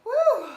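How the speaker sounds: high, loud celebratory whoop; finishing whoop; loud woo. Real, not acted. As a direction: whoop at the top of your lungs. Cry after finishing the curls.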